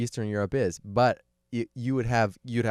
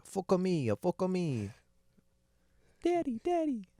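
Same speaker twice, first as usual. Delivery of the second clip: high falsetto clips, climbing higher; soundboard robot woman; 10 semitones higher, slower than usual. Retold or imitated. Host imitates a soundboard of robot phrases, high falsetto.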